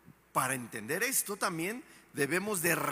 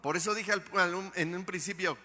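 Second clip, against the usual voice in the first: ordinary talk, nothing marked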